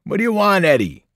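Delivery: annoyed trucker yelling. A narrator's reading. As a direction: shout out through the trailer wall. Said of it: "trucker inside with us yelled".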